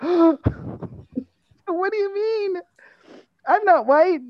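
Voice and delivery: high-pitched, aghast protest; hammed-up indignant disbelief; mock shock. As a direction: gasp it out high, scandalized.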